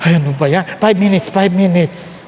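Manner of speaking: low, drowsy mumbling; groggy sleeper. Retold or imitated. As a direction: mumble like someone just woken by the alarm. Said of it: "Pagising mo lang, alarma".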